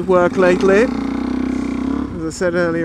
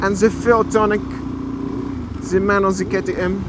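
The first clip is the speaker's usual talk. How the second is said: put-on French accent. Vlogger recapping the ride for fun, French accent. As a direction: talk like a roughly faked Frenchman. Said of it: "This accent, I don't know why I'm doing it, it's kinda French".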